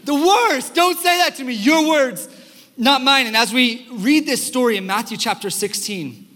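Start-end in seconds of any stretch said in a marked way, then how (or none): 0.0-3.3 high, loud mock outrage; hammed-up protest, much higher and louder; playfully yelling in offended disbelief; mock outraged shout. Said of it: "And I'm like".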